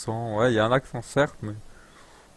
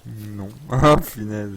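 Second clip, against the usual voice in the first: ordinary talk, nothing marked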